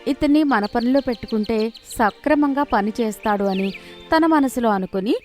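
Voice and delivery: plain reading voice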